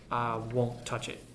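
low, soft and slow refusal; sullen. A narrator's reading. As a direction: mutter a stubborn no. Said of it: "he said sullenly".

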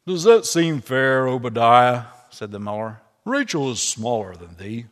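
lightly voiced hearty old miller. Miller answering Obadiah, lightly voiced hearty old man.

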